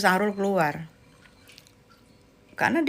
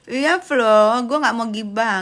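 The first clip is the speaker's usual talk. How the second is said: usual speaking voice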